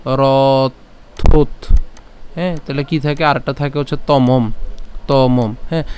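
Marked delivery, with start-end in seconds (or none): 0.1-1.7 রথোত stretched very slowly and firmly; drawn-out syllable. Shown as a demonstration. Teacher stretches the word for students, very slow. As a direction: hold each sound of রথোত long. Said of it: "এখানে ত পর্যন্ত একটা রথোত".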